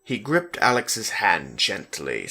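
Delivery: plain reading voice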